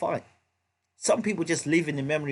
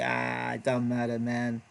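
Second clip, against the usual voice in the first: low, soft, couldn't-care-less mumble; put-on indifference, pitched down and quiet; imitated private indifference; 6 semitones lower, 4 dB quieter than usual. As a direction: shrug it off privately as the fighter. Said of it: "Behind closed doors, he might be".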